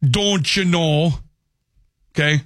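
slow, stretched upper Midwest accent; mock upper Midwest accent with long vowels. Shown as a demonstration. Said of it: "there's an accent everywhere".

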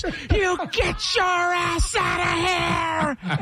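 high, slow, strained furious yell. Retold or imitated. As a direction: blow a gasket at the dog, dragging out every word. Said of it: "would just blow a gasket".

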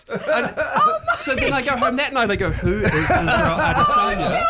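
high, laughing and slowed. Real, not acted. Retelling his ignorance of the fighter, laughing. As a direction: laugh through the telling.

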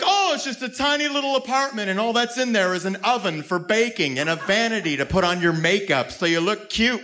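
hammed-up sarcastic feminist; mocking feminist. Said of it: "You'd be like".

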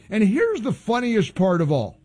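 ordinary talk, nothing marked

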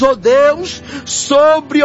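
normal talking voice

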